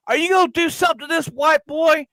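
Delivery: high, demanding woman's voice; imitating the girlfriend. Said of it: "and she says".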